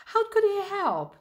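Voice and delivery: high, loud dramatic question; urgent questioning. A narrator's reading. Narrator wonders how to save the fish, urgent. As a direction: raise the stakes with an anxious question.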